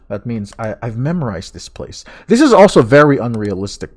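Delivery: ordinary talk, nothing marked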